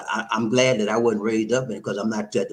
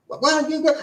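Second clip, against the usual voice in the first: mock girlfriend plea; 4 dB louder, faster than usual. Girlfriend voiced pleading.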